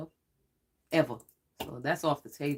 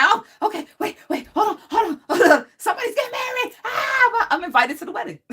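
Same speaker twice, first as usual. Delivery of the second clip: excited high squeal; 11 dB louder than usual. Retold or imitated. Excitedly reacts to spirit's message.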